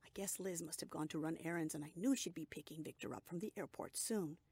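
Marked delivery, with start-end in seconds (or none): none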